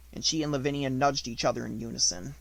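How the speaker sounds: usual speaking voice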